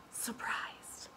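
breathy little hushed word; surprised whisper. Acted. Reading to a child, a hushed surprised whisper. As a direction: whisper it like a discovery.

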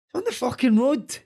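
high, loud mock child's retort; imitated indignant boy. Dad retelling his son's answer to coaches, high indignant mimicry. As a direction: mimic a wee lad shouting back, pitched right up.

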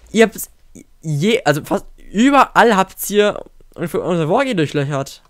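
usual speaking voice

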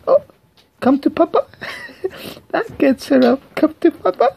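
high coaxing call. Acted. Calling pet playfully.

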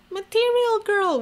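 high, loud sassy sing-song. Pretend. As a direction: toss it off like a diva. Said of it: "why did I read it in that tone?".

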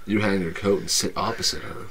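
ordinary delivery

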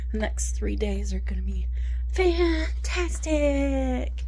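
sarcastic. Real, not acted. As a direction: drag out fantastic with heavy sarcasm. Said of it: "Sarcasm, in case you couldn't tell".

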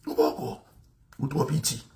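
breathy taunting whisper; hushed mocking question. Real, not acted. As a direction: drop to a whisper to needle them. Taunting aside.

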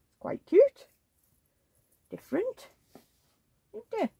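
high sing-song cooing at the doll. Real, not acted. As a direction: gush over the doll in a high coo.

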